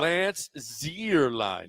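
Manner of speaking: very slow, drawn-out Lance Zierlein; taking your time. Shown as a demonstration. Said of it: "you gotta take your time with it".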